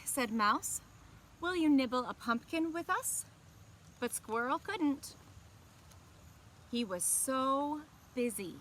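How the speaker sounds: gentle, expressive storybook reading; picture-book narration to young listeners; reading to children. A narrator's reading. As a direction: read it like story time for kids. Story narration and character line.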